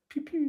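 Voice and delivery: high, quiet imitated zaps; little laser-gun sound; playful pew-pew. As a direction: squeak a soft toy laser noise.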